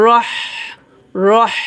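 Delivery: "রহ" com o হ final soprado e prolongado; sopro fino da garganta. Shown as a demonstration. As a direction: solte um fio de ar no fim de cada "রহ". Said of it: "চিকন করে বাতাস বের করতে হবে".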